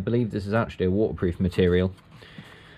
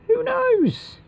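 squeaky high voice. Pretend. Playful musing to self.

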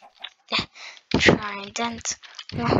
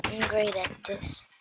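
ordinary delivery

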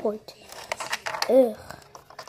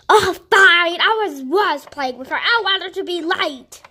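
heated argument; 3 semitones higher, 6 dB louder than usual. Pretend. Arguing with the taxi driver.

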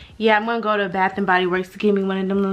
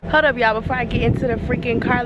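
usual speaking voice

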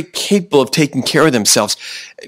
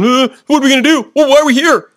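much higher, louder, worried bleating sheep; fretful sheep voice; 12 semitones higher, 4 dB louder than usual. Retold or imitated. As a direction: play a panicky, clueless sheep. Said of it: "Don't be a sheep going".

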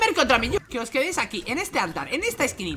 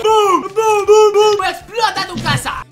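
loud, high booming explosion chant; gloating shout; 9 semitones higher, 7 dB louder, slower than usual. Real, not acted. Player blowing up a rival's house, gloating shout. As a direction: boom it out in triumph.

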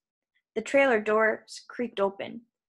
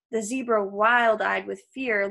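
ordinary delivery, nothing marked

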